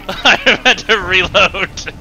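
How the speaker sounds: laughing. Real, not acted. Laughing after dying.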